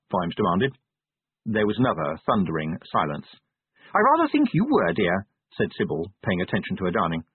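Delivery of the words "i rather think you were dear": high-pitched wife, dropping on "dear"; high, matter-of-fact wife voice; wifely correction. Acted. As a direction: correct your husband lightly, pitch falling at the end. Sybil gently corrects her husband.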